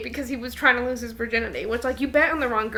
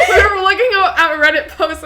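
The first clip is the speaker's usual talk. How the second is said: louder, higher, words through laughter; laughing; 5 semitones higher, 9 dB louder than usual. Real, not acted. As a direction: keep laughing while you explain. Still laughing from the outburst.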